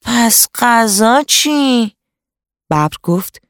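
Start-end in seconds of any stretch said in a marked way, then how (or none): 0.0-1.9 high, drawn-out moaning question; whining jackal, stretched out in dismay; surprised whine. A narrator's reading. Hungry jackal asks the tiger, surprised and moaning. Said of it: "شغال با تعجب و نالان پرسید".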